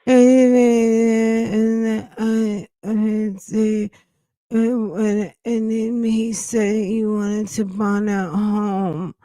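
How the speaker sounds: whiny-child imitation, drawn-out words; mock baby talk. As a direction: mimic her talking baby talk. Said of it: "I'm just gonna talk baby talk".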